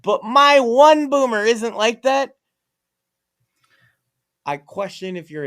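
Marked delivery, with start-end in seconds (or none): 0.0-2.4 high, loud mock whine of protest; slow, hammed-up objection; objecting listener. Imagined listener defending their one boomer, mock protest. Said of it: "you're like".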